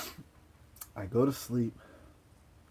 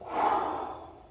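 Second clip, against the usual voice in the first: a long, unvoiced breath out; long exhale. After the deep breath, a long breath out. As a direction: let all the air go in one long release. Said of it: "and went".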